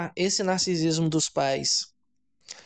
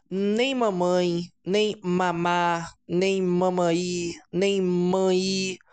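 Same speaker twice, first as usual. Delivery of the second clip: put-on slow, drawn out baby words; much slower than usual. Shown as a demonstration. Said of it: "Quando eu digo não falar nada".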